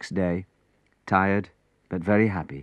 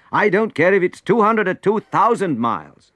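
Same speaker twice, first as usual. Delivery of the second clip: loud and higher, I'm going anyway; determined shout; 9 semitones higher, 5 dB louder than usual. Acted. Prince shouting down his advisor's excuse, determined. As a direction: declare it with fierce resolve.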